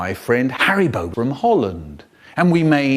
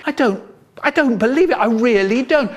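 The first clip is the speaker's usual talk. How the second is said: disbelief; 9 semitones higher, 3 dB louder than usual. A narrator's reading. Narrator's disbelief at the outburst.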